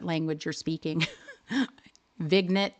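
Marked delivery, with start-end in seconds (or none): 2.2-2.7 vignette as VIG-net, with a hard G; variant pronunciation. Shown as a demonstration. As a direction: say vignette as VIG-nit, G sounded. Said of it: "I've heard 'em all".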